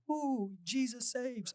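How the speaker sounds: high, drawn-out mock revival whoop; energetic spiritual guy. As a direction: play the hyped-up church guy, stretched and high. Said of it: "you think I'm the energetic, like, spiritual guy".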